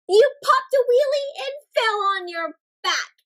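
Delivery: lively storybook read-aloud; reading to children. Acted. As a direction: tell the bike mishap like a picture book. Reading picture book aloud.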